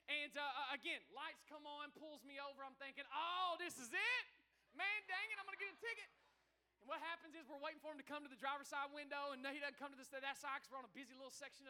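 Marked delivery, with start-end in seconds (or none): 3.1-6.1 high-pitched griping, retold; exasperated dismay. Pastor reliving being pulled over by police, mock exasperation.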